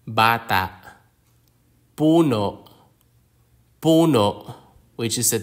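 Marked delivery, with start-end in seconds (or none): 0.1-0.7 "bata" with the stress on BA; crisp teacher's demo of BA-ta; penultimate stress. Shown as a demonstration. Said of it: "stress on the second to last syllable". 2.0-4.5 isolated demo words, PU-no, falling; penultimate stress. Shown as a demonstration. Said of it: "stress on the second to last syllable".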